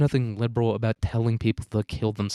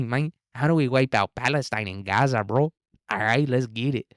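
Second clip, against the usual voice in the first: lilting mock Mexican accent, swooping up and down; caricatured sing-song Mexican accent. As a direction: do a hammed-up Mexican accent, lifting the last word. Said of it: "that's what Sheinbaum says to us".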